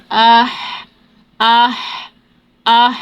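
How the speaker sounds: breathy ah like a sigh of relief; آہ like a cool breath. Shown as a demonstration. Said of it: "ٹھنڈی آہ کی معنی".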